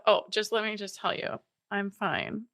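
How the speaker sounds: ordinary talk, nothing marked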